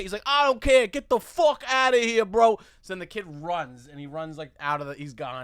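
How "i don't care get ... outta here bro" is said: imitated loud, high-pitched angry shouting; angry dismissal. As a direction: act out a drunk man chasing someone off. Acted drunk man's angry order.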